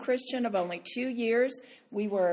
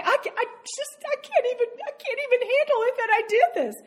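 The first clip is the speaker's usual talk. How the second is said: mock distraught sobbing; 12 semitones higher, 4 dB louder, faster than usual. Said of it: "just sobbing away".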